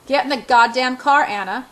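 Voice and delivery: loud angry command, easing on the name; barked order. A narrator's reading. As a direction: bark the order, punching the curse. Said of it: "he barks at me".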